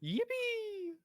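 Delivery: very high, held cartoon yippee; squeaky cheer. Cohost celebrates the stream starting with a high yippee.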